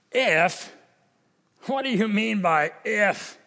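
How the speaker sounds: an indignant, testy "What do you mean by if?"; a hint of annoyance, throwing the "if" back; irritated Jesus. Preacher voicing Jesus as mildly irritated at the father. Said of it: "Is he irritated? Is he indignant? Is he angry?".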